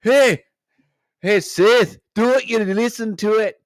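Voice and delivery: high, hammed-up Scottish baboon; comic Scottish rant collapsing into gibberish; Scottish accent. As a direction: open loud in a squeaky Scottish accent. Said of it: "Or do the Scottish one".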